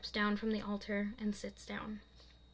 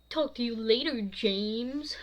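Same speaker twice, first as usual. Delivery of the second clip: mocking singsong; 5 dB louder, slower than usual. Mocking Lily.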